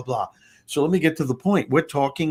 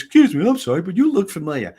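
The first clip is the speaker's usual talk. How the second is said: higher, louder voice of a stranger butting in; imitated intrusive stranger; 4 semitones higher, 5 dB louder, faster than usual. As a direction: lightly act out an eager interruption. Stranger butts in on a plane.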